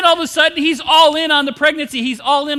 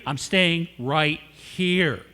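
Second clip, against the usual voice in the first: stubborn refusal; 7 semitones lower, 5 dB quieter, much slower than usual. Retold or imitated.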